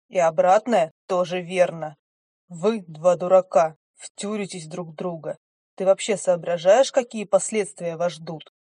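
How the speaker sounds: angry lecture. Acted. Angry stranger lectures heroine.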